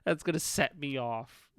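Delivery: usual speaking voice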